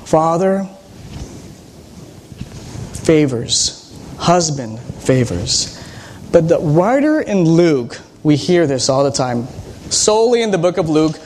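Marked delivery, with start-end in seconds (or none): 0.0-5.8 slow, weighted father favors, husband favors; emphasized. Shown as a demonstration. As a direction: lean on each word slowly. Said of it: "Let me emphasize it".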